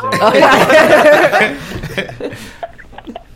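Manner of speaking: high, loud burst of laughter; cracking up. Real, not acted. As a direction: let the laugh explode. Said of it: "Oh, she's cracking up".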